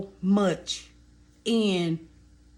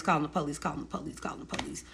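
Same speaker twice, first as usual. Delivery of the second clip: each repeat softer until it's only breath; fading to a whisper; 4 semitones lower, 10 dB quieter, much faster than usual. Shown as a demonstration. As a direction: let the phrase die away into a whisper. Repeats phrase, voice fading away.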